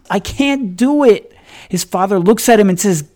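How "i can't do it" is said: put-on high, slow, panting boy's voice; exhausted boy forcing the words out between breaths. A narrator's reading. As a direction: gasp it out, spent from pushing the stump. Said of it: "between heavy breaths".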